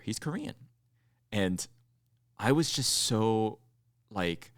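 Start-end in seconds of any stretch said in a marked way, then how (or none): 0.0-0.5 a lightly voiced barista, high and soft; imitating the barista. Barista tells customer his favorite player is Korean, in a high soft voice. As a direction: mimic the cashier's soft, high-pitched remark. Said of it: "He goes".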